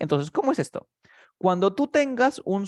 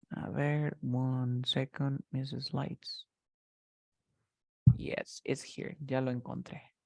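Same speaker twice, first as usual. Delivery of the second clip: much softer, slow, absent-minded; talking to oneself; 2 semitones lower, 9 dB quieter, slower than usual. Real, not acted. Teacher looking for slides, murmuring to self.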